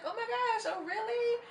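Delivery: high, breathless mock delight; gushing girl. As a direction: play her as a squealing, thrilled girl. She's like, gushing reaction.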